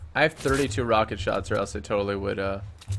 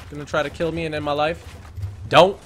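ordinary delivery

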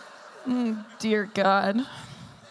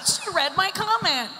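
much higher, delighted mock voice; imitating a troll; 10 semitones higher than usual. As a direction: squeal it like a thrilled troll. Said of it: "one just clapped".

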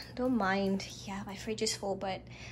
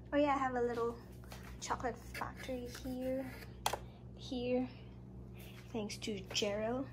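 normal talking voice

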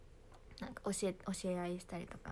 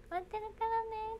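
much higher, sugary idol voice; cute idol promise; 13 semitones higher than usual. Acted. Idol promising fans she will wait, sugary high. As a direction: promise sweetly like an idol winking. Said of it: "何でも言えるので".

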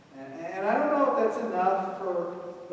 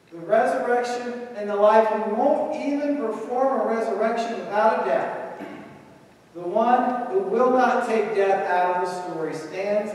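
louder proclamation, pitch lifted; forceful preaching; 5 semitones higher, 7 dB louder than usual. Acted. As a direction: declare it boldly. Preaching climax.

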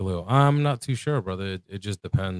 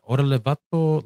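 imitating a Mexican street accent, higher; a quick jab of Mexican slang voice; mock Mexican slang; 6 semitones higher, 4 dB louder than usual. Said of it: "I don't speak like".

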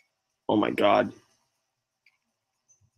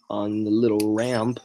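normal talking voice

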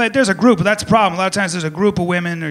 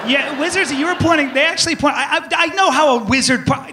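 higher, fast flustered nerd; higher, hurried mock nerd rant; 6 semitones higher than usual. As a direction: ramble like a pedantic fan being cut off. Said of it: "I've had that a couple times where like".

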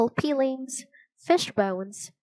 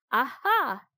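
excited; 4 semitones higher than usual. A narrator's reading. Teddy discovers the table.

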